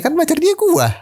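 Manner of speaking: high, baffled protest; incredulous squeak; confused. Retold or imitated. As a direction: voice jumps way up in disbelief. Confused about who's the boyfriend.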